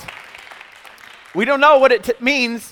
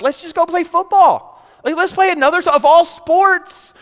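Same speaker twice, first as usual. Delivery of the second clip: put-on a light, higher kid voice, whining to quit golf; seven-year-old whining; 8 semitones higher than usual. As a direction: play the bored kid begging for football, drawing out the end. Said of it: "As a seven-year-old, I'm like".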